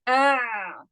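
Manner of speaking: ah from the middle of the throat; throat demo. Shown as a demonstration. Teacher shows class the ayn, sounding ah. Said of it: "let me say".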